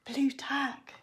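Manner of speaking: laughing whisper, no voice. Real, not acted. Whispering to her child.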